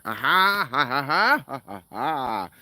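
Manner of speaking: loud, high ha-ha-ha; theatrical laugh. Pretend. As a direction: burst out in a staged laugh, then let it slow.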